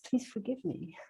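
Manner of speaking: hushed heartfelt apology; soft plea. Retold or imitated. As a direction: say it gently as an apology.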